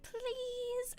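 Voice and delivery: drawn-out high, soft plea; mock pleading, high and quiet. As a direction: beg sweetly in a high little voice. Pleading for shelter, acted.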